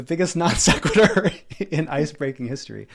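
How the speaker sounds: amused, laugh breaking into the words; real laughter. Real, not acted. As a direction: crack up while telling your own story. Laughs at own story.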